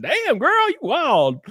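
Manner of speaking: high-pitched, drawn-out admiration; playful high whoop of approval; impressed. Retold or imitated. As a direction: exclaim it like you're wildly impressed. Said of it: "I was impressed".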